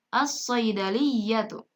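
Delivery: slow "as-saydaliyatu" with a heavy doubled S; silent lam. Shown as a demonstration. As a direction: read "as-saydaliyatu" deliberately, lam merged into shad. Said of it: "lamnya tidak dibaca jelas".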